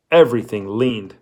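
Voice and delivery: ordinary delivery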